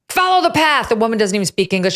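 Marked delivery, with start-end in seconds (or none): 0.1-0.9 yelling agent. Retold or imitated. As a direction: shout the order like an angry customs agent. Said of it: "starts yelling at this other woman".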